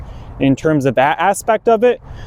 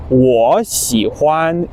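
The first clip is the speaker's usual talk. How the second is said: slow Chinese demo; 2 semitones lower, much slower than usual. Shown as a demonstration. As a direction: spell out "wo xi huan" slowly. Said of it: "I like something".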